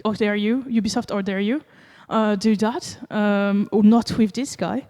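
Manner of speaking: usual speaking voice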